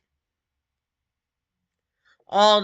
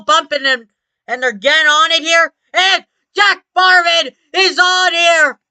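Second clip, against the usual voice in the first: motorsport commentator; 6 semitones higher, 5 dB louder than usual. Acted. Live race play-by-play after crash.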